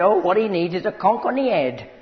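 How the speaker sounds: loud, high-pitched Cockney woman; hammed-up Cockney accent, played for laughs. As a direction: imitate a feisty little Cockney lady. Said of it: "she sounded like a Cockney".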